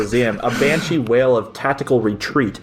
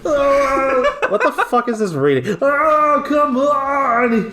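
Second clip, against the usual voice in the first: shouting; 11 semitones higher, slower than usual. Acted. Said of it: "he shouted".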